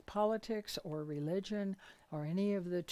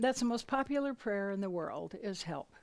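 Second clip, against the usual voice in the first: ordinary talk, nothing marked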